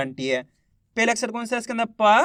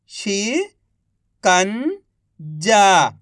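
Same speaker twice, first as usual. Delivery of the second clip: each syllable drawn out very slowly; slow syllable-by-syllable reading; much slower than usual. Shown as a demonstration. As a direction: sound out सुरंग slowly as सु-रं-ग, one sound at a time. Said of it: "एक अक्षर का उच्चारण करके".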